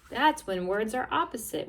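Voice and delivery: normal talking voice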